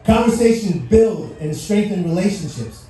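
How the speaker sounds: ordinary talk, nothing marked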